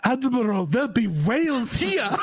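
a hammed-up sci-fi officer shout; Star Trek quote. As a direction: call it out to the admiral.

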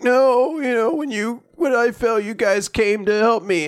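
high, mock-tearful kid; imitated crying. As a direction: whimper it like a kid playing at tears. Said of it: "like, crying".

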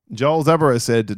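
ordinary talk

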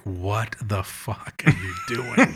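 slow, hushed mock priests; imitating stunned priests; priests' silent look. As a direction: voice the priests' baffled stare, slowly. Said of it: "priests are all looking at me like".

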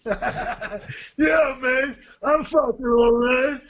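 hammed-up rage, half laughing; mock angry cursing. Retelling club boss Billy cursing him out of the office, mock furious. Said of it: "that's how he spoke".

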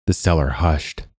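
ordinary delivery

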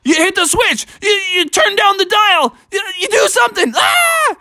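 very high frantic shouting, ending in a scream; hammed-up storybook panic; panicked fishermen. A narrator's reading. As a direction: stammer frantic orders way up high. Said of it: "the fishermen were like".

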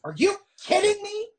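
high, incredulous mock yell; hammed-up indignant shout back. A narrator's reading. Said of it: "I yell back".

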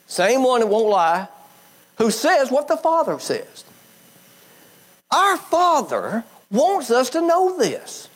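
rising preaching fervor. Real, not acted. Preacher to congregation, climbing in fervent excitement.

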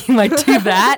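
wet, spit-filled delivery; spitty mouth sound. Shown as a demonstration. Coach demoing a spitty wet voice. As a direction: let spit spray through the mouth. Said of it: "a lot of spit through your mouth".

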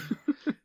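a short high burst of real laughter. Real, not acted. Cohost laughing at the job interview scene.

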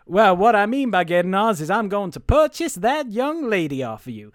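loud, hammed-up Southern accent; Southern tough laying out his deal. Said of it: "where's my Southern accent?".

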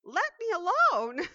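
high, loud cry of protest; clay crying out to its master; yelled protest. Acted. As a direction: shout it out, desperate to be left alone. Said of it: "I yelled out".

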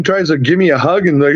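ordinary talk, nothing marked

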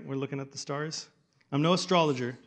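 ordinary talk

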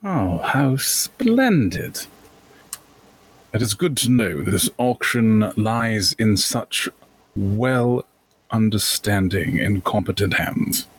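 slow, savoured, refined approval; pleased gentleman merchant. Acted. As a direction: give cultured praise with drawn-out relish. Calder praises the auctioneer.

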